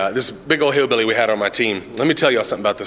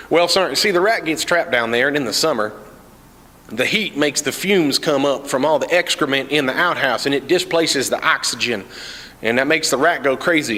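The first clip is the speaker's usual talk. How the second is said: hillbilly explainer; 3 semitones higher than usual. Retold or imitated. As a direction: spell it out like a good old boy. Cletus explains rat.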